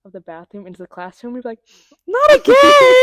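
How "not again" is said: high, loud groaning shout; exasperated shout. Retold or imitated. Kindergarten class yelling at the leak, high and loud. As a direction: yell it like the whole class is fed up.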